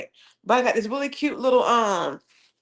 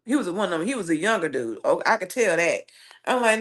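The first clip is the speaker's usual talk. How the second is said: ordinary delivery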